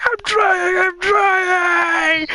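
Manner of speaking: high, wailing imitated shout; very high, desperate acted cry; mock panicked yell. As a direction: yell back in hammed-up panic. Retold panicked answer while skiing.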